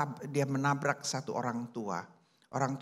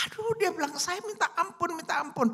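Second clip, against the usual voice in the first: begging for forgiveness; 12 semitones higher than usual. A narrator's reading. Old man begging forgiveness.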